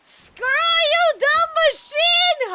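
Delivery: very loud, high, drawn-out yell; frustrated screaming. Real, not acted. Gamer cursing a machine in the game, frustrated scream. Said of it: "I probably need to stop screaming so much".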